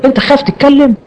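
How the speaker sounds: سؤال عالٍ باستهزاء؛ اسألها بتهكم وتعجب؛ متهكم يسأل بولس باستهزاء عالٍ; mocking question. Mock challenger questioning Paul.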